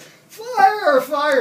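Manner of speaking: high, loud alarm cry; retold yell. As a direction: yell a warning across the lake. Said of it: "and I'm yelling at".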